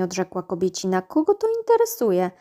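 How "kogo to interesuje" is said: put-on high, loud dismissive scoff from the old gnome woman; shrill scoff. A narrator's reading. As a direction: fling the question up high like a cranky old healer. Old gnome woman scolds husband. (wysoki, głośny, lekceważący okrzyk starej gnomicy)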